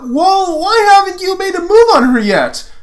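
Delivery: put-on high, loud teasing teen boy; Lance voice. Lance needling Lotor about his crush, high and loud. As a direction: pitch way up to play a cocky Lance. Reading Lance's lines in fanfic.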